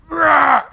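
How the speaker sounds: imitating a lion's roar with a big, high, loud shout. The lion roars back at the pastor, imitated roar. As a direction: let out a mock lion roar.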